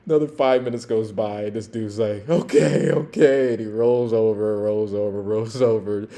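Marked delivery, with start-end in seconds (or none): 2.3-3.5 louder, weary okay; giving in. Retold or imitated. Drunk giving up to police, weary and loud. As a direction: surrender it grudgingly.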